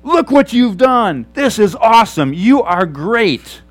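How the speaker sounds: high, loud shout of triumph; joyful shouting. Shown as a demonstration. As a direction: shout your praise like a cheering fan. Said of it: "And yelling, shouting, loud".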